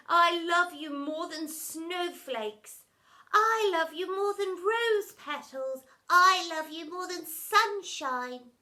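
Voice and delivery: soppy declarations. A narrator's reading. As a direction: coo each line to each other. Family declaring love.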